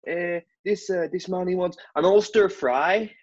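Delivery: mock Italian waiter voice; a hint of an Italian accent. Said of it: "the fellas in Italy".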